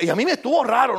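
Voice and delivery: ordinary talk, nothing marked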